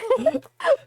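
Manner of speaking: high-pitched burst of laughter. Real, not acted. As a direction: squeals with laughter.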